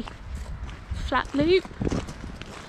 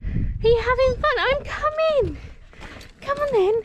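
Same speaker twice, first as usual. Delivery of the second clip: much higher, sing-song talk to the dog; cooing at the dog as they climb the trail; high pet voice; 14 semitones higher than usual. Real, not acted. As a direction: coax the dog along in a high, bright pitch.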